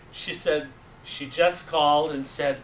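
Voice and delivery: ordinary talk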